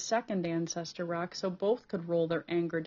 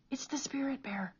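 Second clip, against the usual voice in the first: breathless hushed wonder; awed whisper; 6 semitones higher, 3 dB quieter than usual. A narrator's reading. Cole tells Peter of the bear, awed whisper. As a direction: whisper it in awe.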